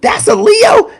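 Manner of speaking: high-pitched mock gasp of disbelief; mock astonished. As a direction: play a stunned onlooker. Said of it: "y'all be like".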